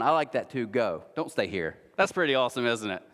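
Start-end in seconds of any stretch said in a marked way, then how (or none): none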